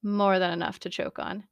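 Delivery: plain reading voice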